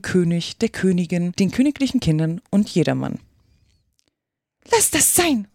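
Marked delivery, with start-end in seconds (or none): 4.7-5.5 high-pitched queen's rebuke; heated scolding. A narrator's reading. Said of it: "eiferte die Königin". (eifernd, mit hoher Stimme)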